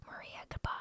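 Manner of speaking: breathy, hushed goodbye; voiceless, mournful farewell; whispered farewell. Retold or imitated. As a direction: whisper it as a stunned send-off. Mock farewell to Maria.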